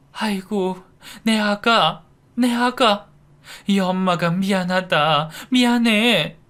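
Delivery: high, tearful lament; sobbing. A narrator's reading. Birth mother sobbing apologies to her daughter. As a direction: weep over your lost daughter. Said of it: "하고 흐느끼더군요".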